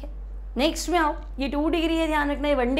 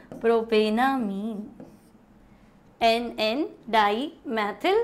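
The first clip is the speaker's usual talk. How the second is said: syllables drawn out, lower, with long gaps; slow dictation; 4 semitones lower, much slower than usual. Real, not acted. Teacher writing out the name for students, slow dictation. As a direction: say it slowly as if writing along.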